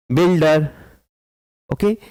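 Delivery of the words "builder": "builder" measured out as two clappable beats; two-beat syllables. Shown as a demonstration. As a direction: say "builder" syllable by syllable, buil-der. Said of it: "you can clap two times".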